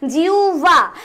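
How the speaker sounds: "জিউভা" with a long jiu, then bha; slow correct pronunciation. Shown as a demonstration. Teacher recaps for students, "জিউভা" drawn out as jiuu-bha. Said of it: "আমি বললাম".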